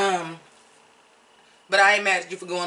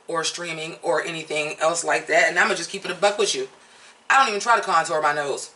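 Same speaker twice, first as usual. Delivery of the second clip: usual speaking voice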